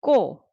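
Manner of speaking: 꼬 said loudly on its own; single syllable demo. Shown as a demonstration. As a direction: isolate 꼬 as one firm, loud syllable. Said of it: "say syllable by syllable".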